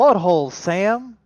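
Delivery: loud outraged yell; shouting. Real, not acted. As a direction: shout it out in disbelief. Outraged outburst at table.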